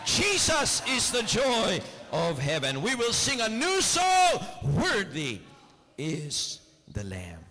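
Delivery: shouted, high-pitched altar call; full-force preaching. Acted. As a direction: preach it out, building to a roar. Preaching climax, crowd. (sumisigaw na pangangaral)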